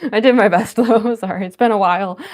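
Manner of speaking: loud, laughing self-defence; laughing apology. Real, not acted. Laughing apology for her bad accent.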